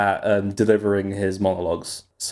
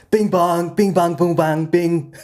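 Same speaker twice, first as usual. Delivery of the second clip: higher-pitched nonsense patter in a politician parody; Donald Trump impression; 6 semitones higher, slower than usual. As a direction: bounce the silly syllables like the parodied president. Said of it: "he randomly just starts going bing bong".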